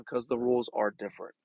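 ordinary talk, nothing marked

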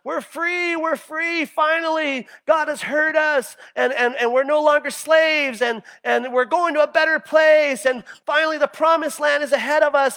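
high, loud, rejoicing cry of freed slaves; joyful praise. Retold or imitated. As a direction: shout it out like people just set free.

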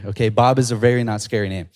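normal talking voice

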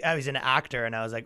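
ordinary talk, nothing marked

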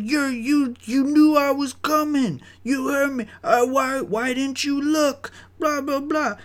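very high-pitched mocking voice; imitated whiny coworker. Coworker excusing herself after dog clash, high mocking imitation. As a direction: mimic her excuses in a squeaky pitch. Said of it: "She's like".

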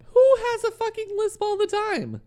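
high, loud indignant squeal; mock offended shriek; outraged squeal. Real, not acted. Indignant protest about lisp.

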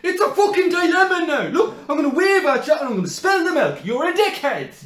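high, loud mock rant; losing it over a friendly wave; mock freak-out. Friend imitating Shane's loud high freak-out while carrying bags. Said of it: "you would just be like".

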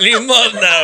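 mock shouted plea. Mock begging call out.

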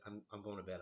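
normal talking voice

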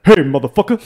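put-on loud, tough New York accent; loud New York-style challenge; intimidating New York accent. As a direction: put on a threatening New Yorker. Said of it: "what's the most intimidating voice I can make?".

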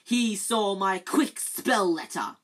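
angry Filch. Acted. Filch accusing.

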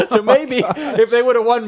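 talking through a big laugh; laughing at his own dark joke. Real, not acted. Laughing while speaking.

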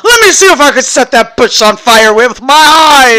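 high loud mock mystic. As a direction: ham up a spooky fire witch. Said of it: "And don't think we're out here going".

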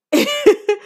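sudden high-pitched burst of laughter; real laugh breaking out. Real, not acted. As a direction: squeal a quick laugh. Laughing at her own slang example.

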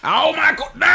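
high yelp of pain; pained shout. Real, not acted. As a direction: cry out, really hurt. Pain from being hit.

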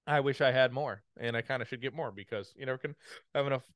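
talking through hiccups; hiccuping between words. Real, not acted. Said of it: "I don't know why I have the hiccups now".

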